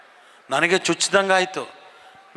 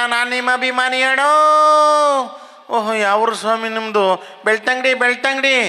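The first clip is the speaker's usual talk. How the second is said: much higher squeaky reels voice; Narasimharaj Ballapura, higher; 8 semitones higher than usual. Retold or imitated. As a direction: push the squeak even higher. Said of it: "ಅವ್ರದ್ದು ಮಾತಾಡಿ". (ಇನ್ನೂ ಎತ್ತರದ reels ಧ್ವನಿ)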